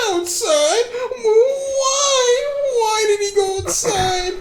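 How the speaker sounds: very high, loud wailing; hysterical panic. Acted. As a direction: shriek and wail in terror for your husband. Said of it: "they heard Ava's panicked voice".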